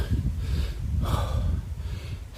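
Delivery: soft unvoiced puff of breath; heavy panting breath. Real, not acted. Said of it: "just catching my breath".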